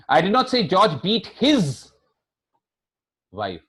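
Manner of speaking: slow down and punch his; stress on HIS. Shown as a demonstration. Teacher hints at another man's wife, stress on his. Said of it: "beaten somebody else's wife".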